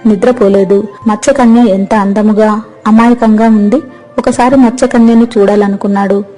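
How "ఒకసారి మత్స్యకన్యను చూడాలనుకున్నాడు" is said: ordinary delivery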